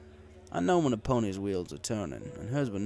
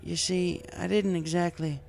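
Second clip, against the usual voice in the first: higher mare voice, hesitant and sheepish; sheepish farm mare; 9 semitones higher than usual. A narrator's reading. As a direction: admit it shyly as the mare. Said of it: "she admitted sheepishly".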